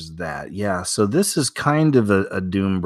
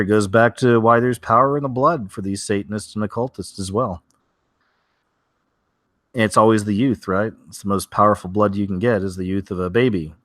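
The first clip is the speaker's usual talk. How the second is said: normal talking voice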